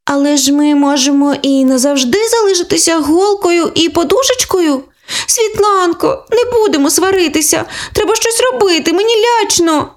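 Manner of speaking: put-on high childlike voice, a touch of fear; a scared sister close to tears; little girl, lightly tearful; frightened little girl. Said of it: "похнюпилася Оленка з повними очима сліз".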